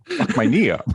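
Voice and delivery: laughing through the punchline, pitch jumping up. Real, not acted. Cracking up at his own joke, laughing the words out. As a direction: crack up as you say it. Joking about blowing his knee.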